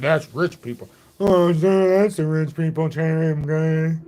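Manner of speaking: snooty, looking down on him; mock the snobby bartender; condescending bartender. Imitating a condescending bartender. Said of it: "that's how he talked to me".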